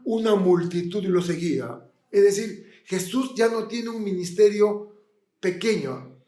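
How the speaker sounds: ordinary talk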